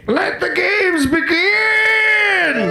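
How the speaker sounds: high, slow shouted prospector voice; hollering like a grizzled old miner. Acted. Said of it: "that sounded like an old prospector".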